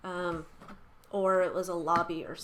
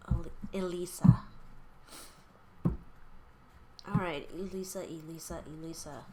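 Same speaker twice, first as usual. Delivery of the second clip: whispered calling; 2 semitones lower, slower than usual. Real, not acted. Reader calling Elisa's spirit in a fading whisper. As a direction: call the spirit in a hushed whisper, dying away.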